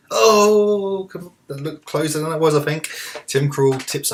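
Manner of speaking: loud high cry of frustration; excited outburst. Real, not acted. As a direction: yell at the screen over the near miss. Reacting to near miss in game.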